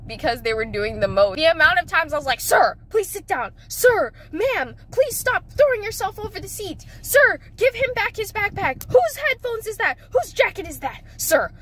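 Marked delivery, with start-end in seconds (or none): 2.4-11.5 raised, higher calls down the bus; rising exasperated calls; calling out to kids. Retold or imitated. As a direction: call the kids out one by one. Driver yelling at kids.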